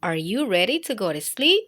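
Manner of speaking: cozy bedtime host voice; bedtime story host. Acted. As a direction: welcome little ones to bed.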